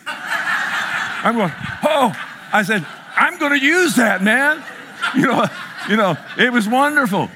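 laughing. Real, not acted. Preacher telling the congregation the Walmart joke, laughing. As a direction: crack up at the punchline. Cashier mix-up punchline.

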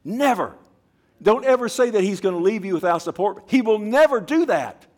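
high, loud insistence on never; fervent shout. Real, not acted. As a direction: hammer it home with fire. Preacher insisting God never leaves.

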